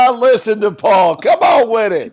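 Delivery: loud high cheer, "come on with it"; delighted hollering; excited shout. Real, not acted. Cheering a friend's joke.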